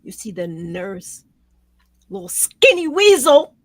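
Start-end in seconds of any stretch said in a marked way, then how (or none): 2.1-3.5 loud and high, spitting out weasel; furious jab. Real, not acted. Host describing the nurse to her guest, furious. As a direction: hurl the insult with contempt. Angry about the nurse on video.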